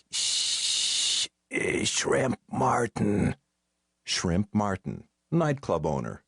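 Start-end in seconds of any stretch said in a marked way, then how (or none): 0.1-3.3 slow, whispered rasp; hoarse drunk caller naming himself; raspy hoarse whisper. A narrator's reading. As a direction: gargle the name out slowly. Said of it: "raspy and hoarse, like someone whispering and gargling".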